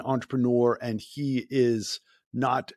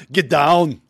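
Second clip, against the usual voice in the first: louder, laughing mock Austrian action-hero accent; Arnold-style accent; 5 semitones higher, 9 dB louder than usual. Host playing along with a louder, laughing Arnold-style accent. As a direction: have fun growling it like Arnold through a laugh. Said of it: "it's actually really good. You're very close".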